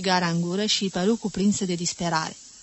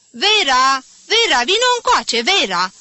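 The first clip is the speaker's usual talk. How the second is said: loud high call through the door; 7 semitones higher, 9 dB louder than usual. A narrator's reading. Mother calling Vera from the doorway, loud. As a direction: call the daughter from the next room. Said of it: "strigă".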